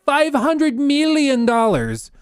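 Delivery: "Five hundred million dollars" high and stretched; drawn-out, high disbelief on "million dollars"; appalled repetition. Real, not acted. Repeats the sum, appalled.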